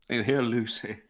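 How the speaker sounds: ordinary delivery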